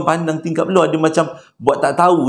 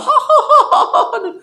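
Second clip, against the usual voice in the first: a much higher ghostly giggle; eerie laugh; 4 dB louder than usual. Retold or imitated. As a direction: laugh like the ghost in the back seat. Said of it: "akak kat belakang tu boleh gelak".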